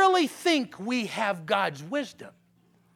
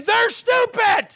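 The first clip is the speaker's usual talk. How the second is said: very high, loud, slow yell; frustrated shout; 10 semitones higher, 9 dB louder than usual. Retold or imitated. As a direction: bellow the insult. Pastor yelling in frustration.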